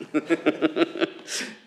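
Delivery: loud, high burst of laughter; hearty laugh. Real, not acted. Amused after teasing the speaker.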